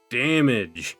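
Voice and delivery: ordinary talk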